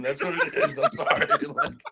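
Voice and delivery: laughing apology, voice up high; real laugh while apologizing. Real, not acted. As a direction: giggle through the sorry. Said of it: "laughing through apology".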